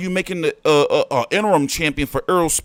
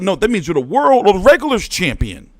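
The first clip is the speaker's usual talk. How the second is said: normal talking voice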